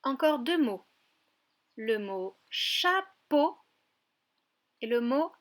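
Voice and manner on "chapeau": "chapeau" very slow, split cha, then po; syllable by syllable. Shown as a demonstration. Teacher presents a word to a child, syllable by syllable. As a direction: break "chapeau" into two separate syllables. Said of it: "J'aimerais que tu retrouves la syllabe".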